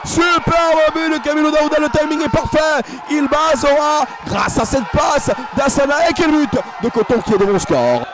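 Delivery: high excited goal call; excited sports commentary. Real, not acted. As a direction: build up and celebrate.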